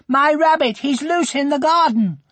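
put-on high, loud little girl; high-pitched, urgent child's wail; panicked cry. A narrator's reading. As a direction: cry out in alarm for a lost pet. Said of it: "cried Sophie".

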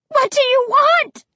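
very high-pitched panicked cry; terrified scream. A narrator's reading. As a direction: scream it in a voice unlike your own. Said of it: "I screamed in a voice".

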